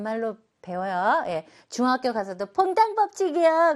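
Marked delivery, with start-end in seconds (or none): 2.5-3.7 high, loud confident answer; cheeky classroom answer; mock student answer. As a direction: blurt it out high like a pupil. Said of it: "그러면 안 돼".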